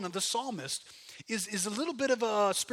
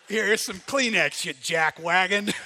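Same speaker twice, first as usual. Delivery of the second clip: drill sergeant counselor; 9 dB louder, slower than usual. Retold or imitated. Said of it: "the drill sergeant is the counselor".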